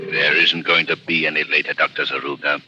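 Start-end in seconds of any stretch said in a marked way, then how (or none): none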